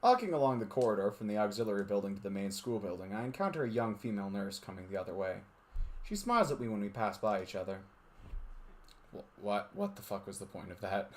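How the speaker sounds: steady narrator reading a visual novel; story narration. A narrator's reading. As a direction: read it like a storyteller.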